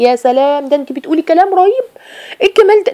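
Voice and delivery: high, rising, over-the-top admiration; gushing praise. Retold or imitated. Flattering friend praising a sister's words, gushing high. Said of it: "يثني عليكي صاحبتك".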